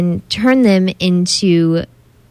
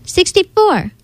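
higher, a hammed-up kid's shout; shouted guess; 11 semitones higher than usual. A narrator's reading. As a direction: call the answer out across the class. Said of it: "Tankia shouted".

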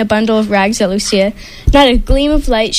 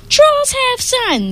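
high, slow chanting trolls; thundering trolls; 14 semitones higher, slower than usual. Acted. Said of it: "the trolls thundered".

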